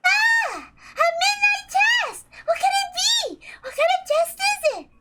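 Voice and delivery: put-on high, childlike voice bubbling with excitement; excited little girl. Maria to her cousins, an excited high childlike squeal. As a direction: squeal like a thrilled young girl. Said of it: "seemed to be very excited".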